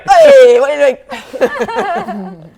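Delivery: very loud, laughing holler; mock shouting. Player mocks their own cover-blowing yell on arrival, playful shouting. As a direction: bellow it as a jokey challenge, drawn out.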